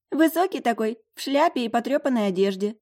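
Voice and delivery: high, loud amused description; amused roommate. A narrator's reading. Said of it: "прыснула в кулак Фабиана". (весело, высоким голосом)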